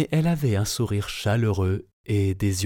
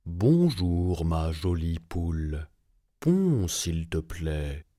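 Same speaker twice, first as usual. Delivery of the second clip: lower, slow coaxing giant; 4 semitones lower, slower than usual. A narrator's reading. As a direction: sweet-talk the hen. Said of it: "dit le géant".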